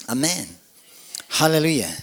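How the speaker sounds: usual speaking voice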